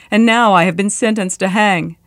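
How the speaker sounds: ordinary delivery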